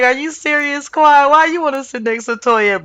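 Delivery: a hint of stunned friend asking why; mock disbelief. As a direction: act shocked at her choice. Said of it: "at first I was like".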